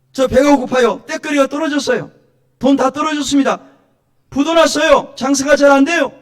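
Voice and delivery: high, loud acted lament; preacher voicing desperate prayers at full voice; crying out to God. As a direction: call each trouble out to God. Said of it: "부르짖으라는 거예요".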